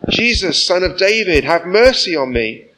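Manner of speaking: loud, high desperate cry for mercy; acted shout. A narrator's reading. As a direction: call out to Jesus as a desperate blind beggar. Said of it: "he began to shout".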